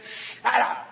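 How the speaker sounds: sudden forceful command; harsh rebuke. Acted. Jesus silences the demon, harsh rebuke. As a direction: cut it off hard and abruptly. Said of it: "he says to that demon".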